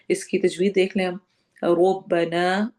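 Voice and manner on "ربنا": ربنا drawn out slowly with a heavy, full R; tajweed demo. Shown as a demonstration. Teacher chanting ربنا slowly for students to copy. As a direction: sound out ربنا with a thick rolled R, the final نا held long. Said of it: "رو کو موٹا پڑھنا ہے".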